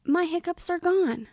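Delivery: triumphant story character; excited relief. Acted. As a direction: announce it with delighted relief. Henry rejoices after the scare.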